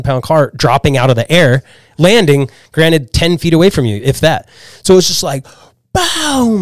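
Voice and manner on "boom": high, drawn-out crash noise; imitated crash. Car lands ten feet away, imitated crash. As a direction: voice the impact as a big boom. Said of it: "it sounded like a car accident".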